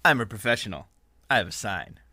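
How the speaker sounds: usual speaking voice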